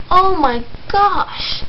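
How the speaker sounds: put-on girl scandalized by a swear, slow; shocked gasp. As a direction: stretch it out, totally shocked.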